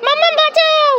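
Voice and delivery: put-on high-pitched child-character cry for rescue; child calling for help. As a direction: call out in panic as a little one. Acted child calls for rescue. (বাচ্চার সরু গলায় বাঁচানোর ডাক)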